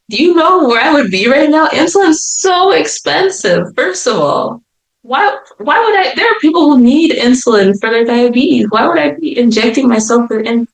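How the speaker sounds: high-pitched, exasperated disbelief; incredulous, swooping up high; indignant protest. Retold or imitated. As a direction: argue against an absurd accusation. Protests absurd accusation of insulin injection.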